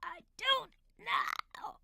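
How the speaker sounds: slow, airy whisper; voiceless, drawn-out repeat. Acted. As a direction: drag it out in a strained whisper. Repeats, still too quiet to hear.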